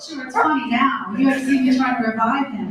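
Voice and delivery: normal talking voice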